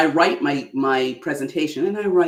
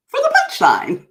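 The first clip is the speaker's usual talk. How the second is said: punchline pop; 7 dB louder than usual. Shown as a demonstration. Delivering punchline after pause.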